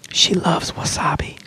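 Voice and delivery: hammed-up breathy whisper imitating dad; dad's whisper. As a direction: whisper it as a silly big reveal. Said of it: "he would, like, whisper it".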